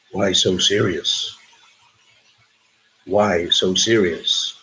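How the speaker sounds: low, slow, drawn-out movie villain; deep, deliberate menacing quote; film villain quote. Acted. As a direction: drop low and stretch each word. Acted film villain quote for quiz.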